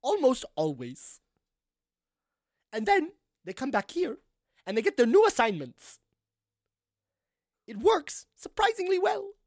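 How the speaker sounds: assured answers in crisp character diction; grand lady mayor. Acted. Mayor explaining the monsters' new assignments, grandly assured.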